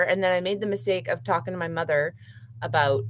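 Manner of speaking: ordinary talk, nothing marked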